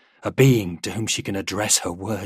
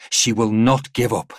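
plain reading voice

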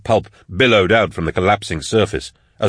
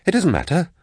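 higher, trying to sound calm; strained calm; 10 semitones higher than usual. A narrator's reading. He brushes off her question, trying to sound calm. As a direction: cover rising panic with forced calm. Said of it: "trying to sound calm".

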